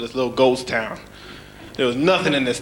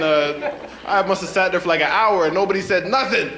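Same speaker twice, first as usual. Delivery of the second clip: usual speaking voice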